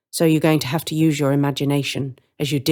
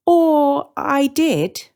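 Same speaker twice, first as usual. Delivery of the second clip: slow, louder, higher ominous line; sinister witch; 11 semitones higher, 5 dB louder, much slower than usual. Acted. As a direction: let the threat hang slowly. Witch hinting menace.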